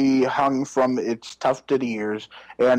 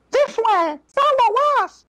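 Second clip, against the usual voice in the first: high excited shout; high calling out; 4 dB louder than usual. Acted. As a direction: call out high and urgent. Fairies calling out urgently.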